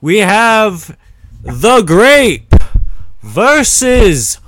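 booming, high, drawn-out ring announcer; fight announcer. Acted. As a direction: shout it out to a whole arena, stretching the names. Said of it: "The announcer, the announcer, uh, says".

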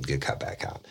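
a quick unvoiced whisper to the listener; whispered aside. Real, not acted. Storyteller whispering an aside to his audience, hushed. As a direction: drop to a whisper as if letting them in on it.

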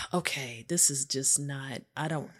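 low, quiet resignation after a sigh; subdued, low-pitched muttering; deflated bafflement. Retold or imitated. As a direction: give up trying to understand, softly. Retold giving up after a sigh.

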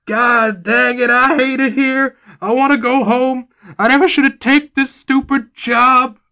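high, loud, whining complaint; whiny docent in pain. Acted. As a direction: whine like a miserable hurt employee. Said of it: "he's crying in pain".

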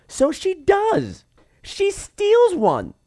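high, slow, sing-song; comic high drawn-out telling. Acted. Narrator relishing the doctor's theft, high and slow. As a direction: stretch it out like a punchline.

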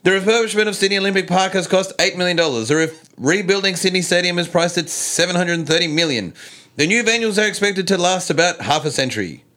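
high, bright news-anchor delivery; reporter voice. Pretend. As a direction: read it like a TV reporter. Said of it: "my best impersonation of Gladys / reporter voice".

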